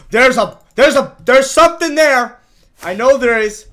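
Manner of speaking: high, loud insistence; insistent shouting. Real, not acted. Gamer sure of a hidden block, insistent shout. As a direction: shout it as certain, exasperated.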